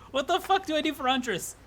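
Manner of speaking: ordinary talk, nothing marked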